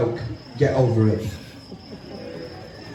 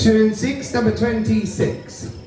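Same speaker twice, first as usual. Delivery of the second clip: bingo caller. Acted. Calls bingo numbers.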